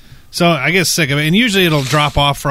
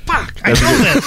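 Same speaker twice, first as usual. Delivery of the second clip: higher, slower, exasperated at yourself; mock self-frustration; 9 semitones higher than usual. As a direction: curse yourself for forgetting. Acting frustrated interview quiz.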